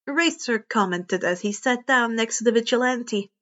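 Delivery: usual speaking voice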